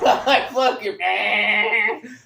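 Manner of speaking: laughing shout; loud real laughter breaking in. Real, not acted. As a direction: cackle through the words. Laughing loudly about the house.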